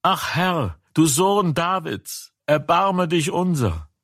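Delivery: high, pleading cry for mercy. A narrator's reading. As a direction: call out to him begging, pitched high. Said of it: "schrien sie und sprachen".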